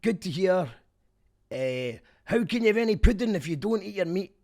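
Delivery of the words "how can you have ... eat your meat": high, loud Scottish shout; Scottish accent. Acted. Quoting the Pink Floyd line, loud Scottish accent. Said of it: "in a Scottish accent".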